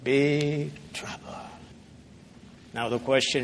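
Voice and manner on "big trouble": softly whispered warning. Real, not acted. Preacher warning the congregation, whispered. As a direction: drop to a whisper to warn.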